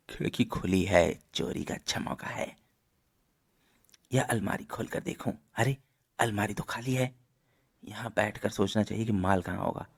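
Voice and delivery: sneak through it softly, as a burglar would; furtive thief. Acted. Thief talks to himself while sneaking.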